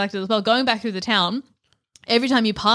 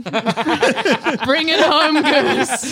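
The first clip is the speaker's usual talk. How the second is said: laughing through a slow, higher line; 5 semitones higher, 4 dB louder, much slower than usual. Retold or imitated. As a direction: cheer it on while laughing. Quoting game, laughing.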